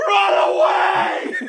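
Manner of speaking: slow, very high screaming yell; panicked shout. Retold or imitated. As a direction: scream it as you flee. Deer bolting in a panic.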